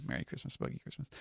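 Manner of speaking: breathy whispered aside. Real, not acted. Reading the title, a quiet amused whisper. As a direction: whisper it to yourself. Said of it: "speaker whispering the title".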